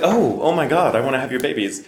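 high-pitched mock swooning; smitten admirer. Smitten stranger hearing the British voice, mock swooning.

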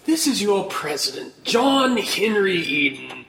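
slow ominous radio president voice; lightly voiced sinister president; John Henry Eden. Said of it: "reminds me of".

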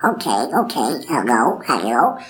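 put-on child practising a quacky duck voice; Donald Duck. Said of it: "spending the whole day going".

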